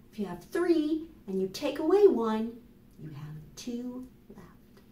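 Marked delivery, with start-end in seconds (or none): none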